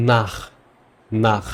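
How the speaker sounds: "নাখ" ending in a raspy ch from the back of the throat; throaty ch. Shown as a demonstration. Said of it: "গলার ভিতর থেকে আসবে খ".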